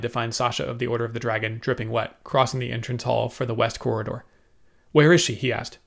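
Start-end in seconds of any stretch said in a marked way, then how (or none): 4.9-5.3 urgent. A narrator's reading. Urgent question on hearing news.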